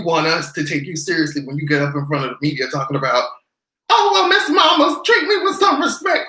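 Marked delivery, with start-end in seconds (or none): 3.9-6.3 high, prissy mock plea; mocking prissy officer. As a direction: mimic a pompous officer. Mocking police spokesperson.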